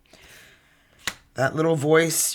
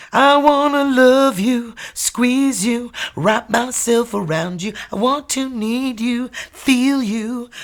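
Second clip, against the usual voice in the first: high, loud, clingy mock lover voice; hammed-up lovesick voice; needy lover; 9 semitones higher, 7 dB louder, slower than usual. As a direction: swoon it out like a desperate romantic.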